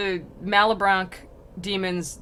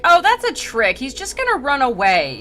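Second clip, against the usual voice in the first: higher, louder demon warning the others; mock alarm; 6 semitones higher, 7 dB louder than usual. Acted demon warns of trick.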